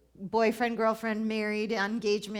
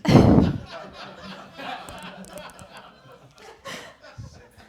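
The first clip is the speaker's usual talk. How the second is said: a long high burst of laughter; loud laugh; 14 semitones higher, 9 dB louder than usual. Real, not acted. Laughing hard at her own joke about sixty.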